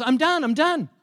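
put-on high, loud child's announcement; excited little boy. Boy interrupts dad with the finished puzzle, excited. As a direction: burst in high and proud.

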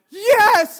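a loud, high, thrilled yes; excited yes. Retold or imitated. Stranger in the elevator, shouting with delight. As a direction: blurt it out like she's spotted a celebrity. Lady recognizes a famous lookalike.

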